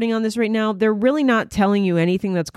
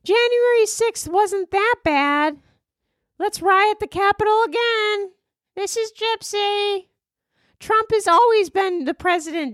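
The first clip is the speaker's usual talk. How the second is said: high, slow put-on girly voice; 12 semitones higher than usual. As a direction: read a silly Cameo message in a high put-on voice. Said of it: "the things people are gonna make her say".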